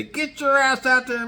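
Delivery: high-pitched, drawn-out mock order; hammed-up slow, high command; mock harassing owner. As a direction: imitate an owner pestering the dog, voice way up. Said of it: "harass my dog and say".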